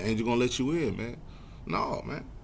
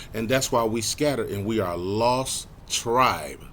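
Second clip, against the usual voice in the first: normal talking voice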